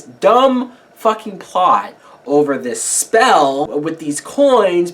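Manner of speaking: ordinary delivery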